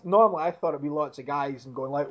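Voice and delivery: ordinary talk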